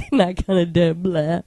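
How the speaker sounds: slow comic presidential impression breaking into mock vomit; Dana Carvey's Bush. Mimicking a TV skit of the vomiting president, slow comic impression. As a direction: drag the catchphrase out, then fake throwing up. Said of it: "Dana Carvey was all over that shit".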